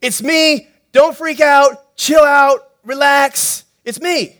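calm them down loudly; urgent reassurance. Acted.

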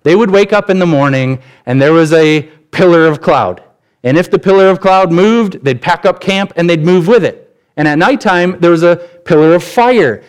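full-voiced sermon climax; preaching at full force. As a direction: drive the story home to the congregation.

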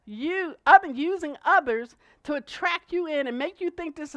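loud, building sermon delivery; preaching at full force. Acted. Preacher warning congregation about false teachers, loud preaching. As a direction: drive the warning home.

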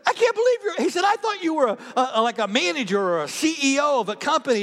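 high, loud astonished voice; incredulous. Retold or imitated. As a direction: blurt it out stunned. Brian shocked by pastor news.

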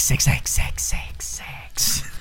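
fading whispered echo. Acted. Closing the spoof science bit, whispered repetition. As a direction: whisper it repeatedly like an ad effect.

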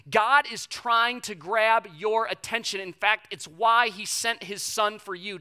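slow, loud, pleading sermon appeal; impassioned preaching. Acted. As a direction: press the truth on every listener. Altar-call style appeal.

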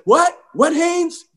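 high-pitched, impatient mock questioning; exasperated coach. Coach answering a pestering player on the sideline, exasperated. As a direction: imitate a coach being pestered.

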